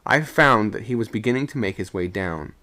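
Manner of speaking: ordinary delivery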